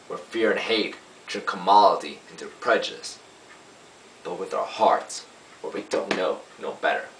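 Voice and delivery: impassioned plea to a jury, punched key phrases; lawyer's summation. Acted. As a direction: build force in deliberate phrases, then ease off at the close. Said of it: "I play a lawyer".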